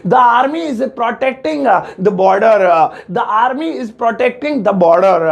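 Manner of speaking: slow, careful model English; slow clear teacher dictation; teaching model sentence. Shown as a demonstration. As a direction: dictate it slowly to the class. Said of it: "तो इसकी English होगी".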